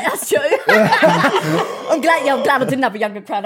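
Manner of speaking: high, loud laughing speech. Real, not acted. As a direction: laugh as you speak. Laughing at the joke.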